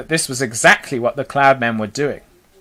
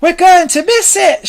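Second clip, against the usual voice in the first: high, loud panicky call; shouting; 5 dB louder than usual. A narrator's reading. Ladybird shouts as the rainbow nears, high shout. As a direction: shout it out, pitched right up. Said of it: "shouted the ladybird".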